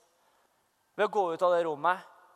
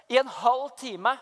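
normal talking voice

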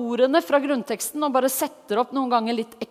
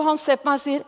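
normal talking voice

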